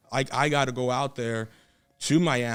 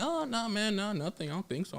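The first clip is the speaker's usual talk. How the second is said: softer, higher, playing it cool; feigned nonchalance; 7 semitones higher, 10 dB quieter than usual. Wrestler on the phone hiding his excitement, casual. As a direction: play down your excitement, keep it small. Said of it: "trying not to act overexcited".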